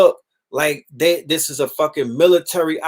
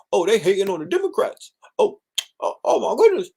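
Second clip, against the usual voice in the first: higher, whiny mock loyalty to the Democrats; sarcastic imitation of a clueless Democrat fan; mocking naive partisans; 7 semitones higher than usual. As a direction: ham up a gullible supporter, pitched up.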